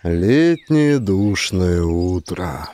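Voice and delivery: ordinary delivery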